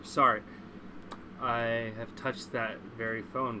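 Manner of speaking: normal talking voice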